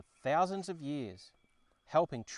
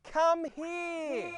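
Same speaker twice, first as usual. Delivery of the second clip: high, loud summoning cry; a piercing cry of come here; 7 dB louder than usual. Acted. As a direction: call out across the mountains. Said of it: "The song had a message, loud and clear".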